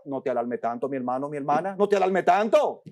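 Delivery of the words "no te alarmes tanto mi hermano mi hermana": lower, soft reassurance; calm them gently; preacher softly soothing the congregation. Pretend. Soothing reply.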